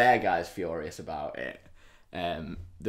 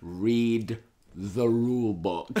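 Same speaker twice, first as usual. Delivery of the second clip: drawn out word by word; slow taunt; much slower than usual. Retold or imitated. Manager winding up his furious rival, slow and deliberate. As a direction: stretch every word to wind someone up. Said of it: "Like this".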